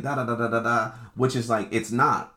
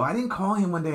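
higher, lighter take with didnt stressed; higher retelling; 9 semitones higher than usual. Retold or imitated. Retelling the missed call, pitched up and incredulous. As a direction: lift it up, quick and incredulous. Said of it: "I go".

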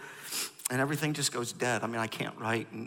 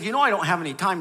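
frustrated; 6 semitones higher, 12 dB louder, faster than usual. Retold or imitated. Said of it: "so frustrated that I said".

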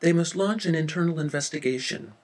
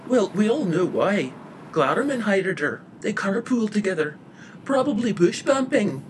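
high, loud knowing gossip; sly gossip; 5 semitones higher, 5 dB louder than usual. A narrator's reading. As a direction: pronounce it like juicy gossip.

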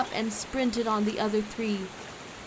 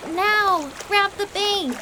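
high, loud shriek; piercingly urgent high yell; shrieked command; 10 semitones higher, 6 dB louder than usual. A narrator's reading. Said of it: "Quincy shrieked".

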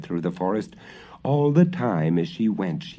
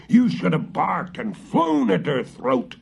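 high, angry shout at the dog; witch shouting; 9 semitones higher, 3 dB louder than usual. A narrator's reading. Witch shouting at her guard dog, high angry yell. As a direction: yell the accusation. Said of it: "shouted the witch".

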